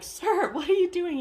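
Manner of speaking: a shocked madam scolding the intruder; madam bursts in, high and loud in outrage; indignant madam. Retold or imitated.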